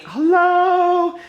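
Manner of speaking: high, slow, incredulous hello; exasperated at the messy bookshelf, hello. Acted. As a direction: act fed up with your own mess.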